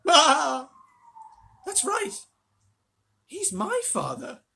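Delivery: high wailing lament. A narrator's reading. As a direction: wail it out. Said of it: "wailed Missus Bucket".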